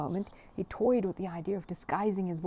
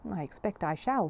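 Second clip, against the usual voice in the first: plain reading voice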